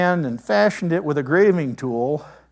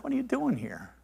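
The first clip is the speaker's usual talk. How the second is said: still small voice; 7 semitones higher, 9 dB quieter than usual. Retold or imitated. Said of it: "the still small voice".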